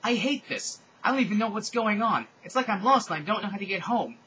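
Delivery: high, loud acted wail; hammed-up tearful whining; crying outburst. A narrator's reading. Draco breaking down at dinner, loud tearful wail. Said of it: "began to cry".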